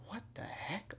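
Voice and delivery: whispered astonishment, very soft; baffled under the breath; hushed disbelief. Retold or imitated. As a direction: drop to a stunned, voiceless whisper. Retelling own stunned disbelief.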